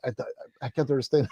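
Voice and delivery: under the breath, lost in the conversation; quiet confused aside with a laugh; hushed, bewildered mutter; muttered bafflement. Retold or imitated. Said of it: "I was like".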